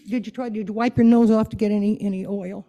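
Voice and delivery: ordinary talk, nothing marked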